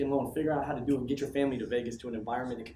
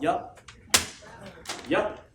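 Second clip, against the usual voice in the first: normal talking voice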